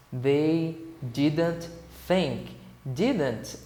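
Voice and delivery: very slow, word-by-word demonstration; slow model reading. Shown as a demonstration. Teacher modelling the sentence for students, slow and separated. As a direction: spell it out slowly, one word at a time. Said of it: "como que se pronuncia".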